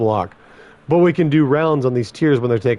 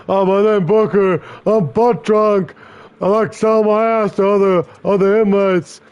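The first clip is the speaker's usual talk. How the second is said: mocking inmate voice; 8 semitones higher, 4 dB louder than usual. Guard mimicking an inmate to embarrass him before the tier, mocking.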